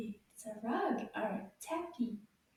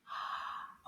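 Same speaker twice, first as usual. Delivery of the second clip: gasp; 9 dB quieter than usual. Acted. Teacher reveals a new picture.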